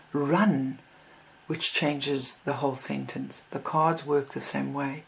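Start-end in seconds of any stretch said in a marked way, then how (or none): 0.1-0.8 "run" set apart, pitch swelling up then falling; stress on run. Shown as a demonstration. Teacher shows how emphasis changes a sentence, "run" stressed. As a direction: punch "run" as its own beat. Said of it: "put the emphasis on".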